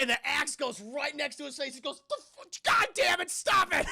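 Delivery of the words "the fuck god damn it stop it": high loud angry yell; mock furious yell; startled angry yell. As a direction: shout at her to stop. Axe hits wall by face.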